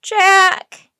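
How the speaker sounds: very loud, high cry; full-voiced distressed call. A narrator's reading. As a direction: cry out the name desperately. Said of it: "cried Annie".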